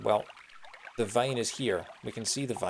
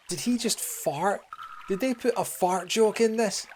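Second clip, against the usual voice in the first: surprised; 9 semitones higher, slower than usual. Real, not acted. Surprised by a fart joke.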